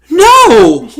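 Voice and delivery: high, loud mock-angry cry; retold mad shout. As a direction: shout it as an indignant retort. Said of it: "she's just so mad".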